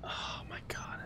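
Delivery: breathless, almost silent whisper; barely voiced relief after a fright; shaken whisper. Real, not acted. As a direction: whisper it still rattled. After the scare, catching breath.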